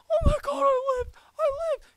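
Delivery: very high, loud squealing imitation; mock overjoyed opponent. Gamer mimicking opponent who survived, mock ecstatic squeal. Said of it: "He's like".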